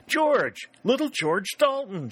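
put-on suddenly knowing the boy she once spanked; delighted surprised shout; joyful cry. A narrator's reading. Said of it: "she cried".

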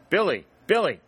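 summoning her son from inside; a mother's loud call. A narrator's reading. Mother calling son from house.

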